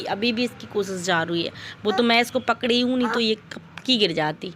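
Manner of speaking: normal talking voice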